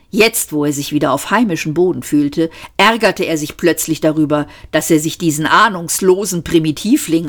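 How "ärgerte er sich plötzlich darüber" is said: usual speaking voice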